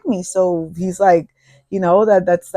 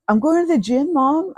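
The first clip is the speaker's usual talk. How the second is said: put-on higher, innocent teen-girl voice; daughter's excuse; 7 semitones higher than usual. Girlfriend lying to her mom, high and innocent. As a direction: fib sweetly to mom.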